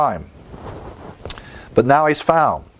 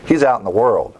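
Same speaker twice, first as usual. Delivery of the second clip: ordinary talk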